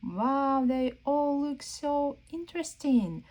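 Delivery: ordinary talk, nothing marked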